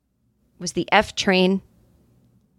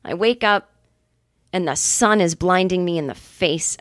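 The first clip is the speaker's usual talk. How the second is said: ordinary delivery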